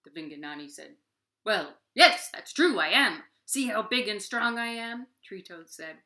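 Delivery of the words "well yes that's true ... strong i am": loud proud giant voice; preening monster. Acted. Vinganani agreeing he is strongest, loud boastful voice. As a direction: show off like a vain giant.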